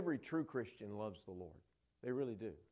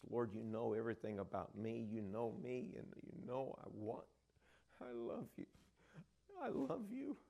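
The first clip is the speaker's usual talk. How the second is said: tearful Peter; 5 dB quieter than usual. Acted. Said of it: "And Peter just starts weeping".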